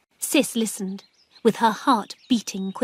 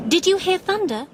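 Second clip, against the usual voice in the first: higher, calling across the yard; 10 semitones higher, 3 dB louder than usual. A narrator's reading. Sis calling to her aunt before the storm, raised call. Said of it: "she called".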